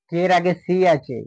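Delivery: ordinary talk, nothing marked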